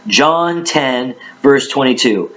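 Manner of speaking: ordinary talk, nothing marked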